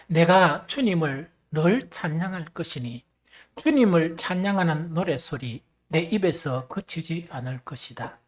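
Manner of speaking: loud, measured scripture reading; leading verse recitation. Real, not acted. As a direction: lead the congregation in reciting the verse. Leads congregation in reading a verse. (또박또박 힘 있게 성경 봉독)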